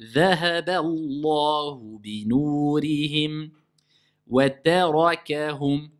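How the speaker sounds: slow, sustained chant with long vowels; deliberate tajwid chanting; Quran recitation. Shown as a demonstration. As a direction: recite measuredly, drawing out each long syllable. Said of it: "C'est pas ah".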